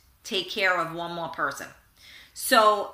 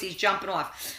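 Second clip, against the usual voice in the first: ordinary delivery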